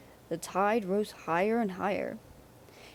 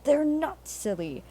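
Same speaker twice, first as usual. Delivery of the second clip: higher, louder protest; cross Percy; 7 semitones higher, 5 dB louder than usual. A narrator's reading. Percy snaps back at Henry, cross and louder. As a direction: defend his friends hotly. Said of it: "He was cross".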